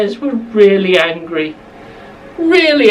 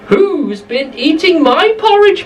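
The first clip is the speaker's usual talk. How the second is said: higher, lilting mother bear; Mummy Bear voice; 8 semitones higher than usual. Acted. Narrator to children, Mummy Bear finds her porridge touched, high voiced. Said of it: "Mummy Bear said".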